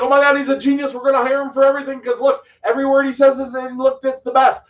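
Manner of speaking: mock the people who'd call him a genius; lightly voiced, excited admirers; gushing fans. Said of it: "people would be like".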